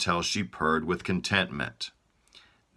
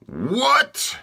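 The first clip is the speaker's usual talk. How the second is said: much higher, loud shocked yell; yelling king; 5 dB louder than usual. Acted. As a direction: yell it in royal disbelief. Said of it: "yelled the king".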